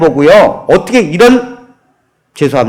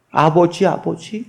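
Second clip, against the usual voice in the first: pleading whisper; 7 dB quieter than usual. Acted. Mute daughter pleads with father.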